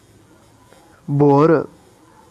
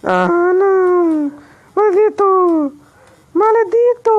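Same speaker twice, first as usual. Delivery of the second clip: very high, loud mock curse; outraged cry; 11 semitones higher, 5 dB louder, much slower than usual. Real, not acted. Gamer cursing Vitor for killing him, high and loud. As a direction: wail at the betrayer.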